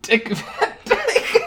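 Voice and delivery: saying the name through a high laugh. Real, not acted. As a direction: laugh it out, amused. Amused at the casting answer.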